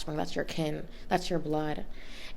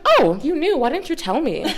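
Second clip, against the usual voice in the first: high, loud mock shock; daughter's retold disbelief at mom, high and loud; incredulous surprise; 9 semitones higher, 6 dB louder than usual. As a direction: act out a stunned oh, you knew. Mom says she knew.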